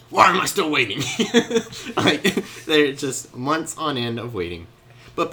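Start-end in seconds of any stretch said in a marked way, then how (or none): 0.1-1.0 loud, high cry of impatience; exasperated outburst. Acted. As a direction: burst out, fed up with waiting. Patience snaps after months of waiting.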